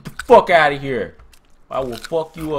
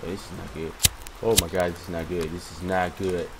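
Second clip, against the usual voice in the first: low, hushed repetition; soft, sinking worry; uneasy dread; 8 semitones lower, 9 dB quieter than usual. Real, not acted. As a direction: murmur it low as trouble nears. Dread at danger in game.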